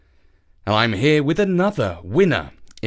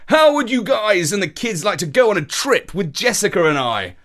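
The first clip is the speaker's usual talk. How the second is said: higher pitched, eager invitation; excited; 7 semitones higher than usual. A narrator's reading. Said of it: "he asked excitedly".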